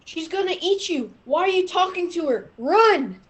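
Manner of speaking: ordinary delivery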